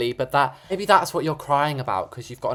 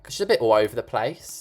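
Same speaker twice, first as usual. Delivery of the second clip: usual speaking voice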